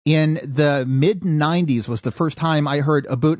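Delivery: ordinary delivery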